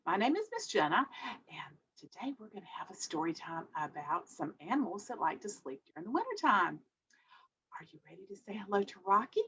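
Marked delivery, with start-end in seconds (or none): 1.5-9.4 fast secretive whisper; playful whisper. Pretend. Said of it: "whispering to the kids".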